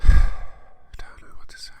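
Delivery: hushed defeated whisper; whispered aside. Real, not acted. Reader giving up after the author's note. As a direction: breathe it out, lost for words.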